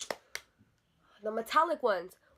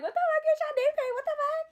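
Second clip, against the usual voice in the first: very high, loud indignant squeal; outraged protest; 3 dB louder than usual. Retold or imitated. Teller replaying her protest to the salon worker, outraged squeal. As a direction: shriek it back in exaggerated disbelief.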